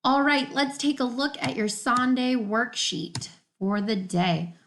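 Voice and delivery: ordinary talk, nothing marked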